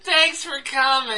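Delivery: high, drawn-out, loud phony thanks; hammed-up fake-friendly send-off; fake sweetness. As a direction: gush insincerely through a pasted-on smile. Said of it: "the smile just melts".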